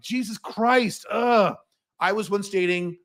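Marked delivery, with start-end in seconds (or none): 0.0-1.6 slow, drawn-out exasperated groan; higher, stretched words ending in disgust; acted exasperation. Customer reacting to grating salon chatter, acted exasperation.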